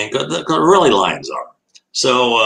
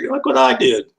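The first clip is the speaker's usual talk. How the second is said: much higher, proud little voice; proud lion voice. Retold or imitated. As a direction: voice the lion showing off its mess.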